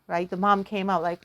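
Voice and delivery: usual speaking voice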